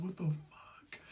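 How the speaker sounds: very soft, unvoiced disbelief; stunned whisper. Passenger spotting tissue in man's pants, stunned whisper. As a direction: whisper it, aghast.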